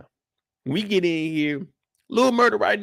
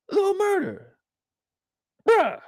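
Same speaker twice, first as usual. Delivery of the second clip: higher, exasperated call to Little Murda; 12 semitones higher than usual. Real, not acted. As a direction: call him out in disbelief. Calling out Lil Murda.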